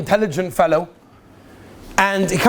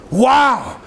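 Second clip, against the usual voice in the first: awed wow; 4 semitones higher than usual. Retold or imitated. Man seeing the orchestra, awed wow.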